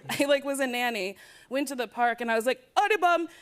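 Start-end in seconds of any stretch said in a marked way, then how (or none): 2.8-3.3 raised, loud fake Jamaican accent. Nanny faking it at the park, Jamaican accent. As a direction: call out in a put-on Jamaican accent. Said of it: "she knew I was faking the accent".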